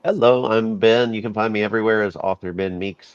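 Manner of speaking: ordinary talk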